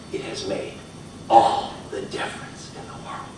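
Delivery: whispered, soft Thoreau line; hushed quote. Retold or imitated. Speaker quoting Thoreau to audience, soft whisper. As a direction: breathe the words almost unvoiced. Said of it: "as Thoreau said".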